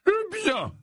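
very high, cheery professor voice; joyful Slughorn. Acted. Slughorn opens his small potions class, joyful high voice. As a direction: chirp it merrily, far above normal pitch. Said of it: "l'air joyeux".

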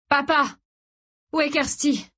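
high, urgent cry to Dad; frantic child's call. A narrator's reading. Son asking his collapsed father about Kirsty, frantic.

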